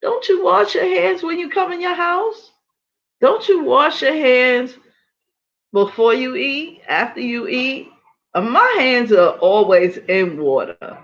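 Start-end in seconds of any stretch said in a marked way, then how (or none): 0.0-7.9 incredulous. Retold or imitated. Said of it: "I'm like".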